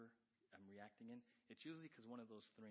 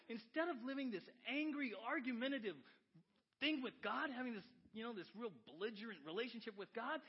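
high, loud, impassioned sermon; fired-up preacher; full-force preaching; 13 semitones higher, 14 dB louder than usual. Acted. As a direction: keep the pulpit fire going.

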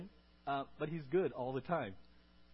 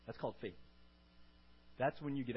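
soft, weighty repeat; 4 semitones lower, 7 dB quieter, faster than usual. Shown as a demonstration.

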